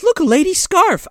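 high, bright find; mock fairy discovery. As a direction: point out the clue brightly. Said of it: "she's like".

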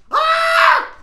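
loud, very high scream; short full-volume shriek, pitched sky-high; demonstrated scream. Shown as a demonstration. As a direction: let out one piercing shriek. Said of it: "That was when I was screaming".

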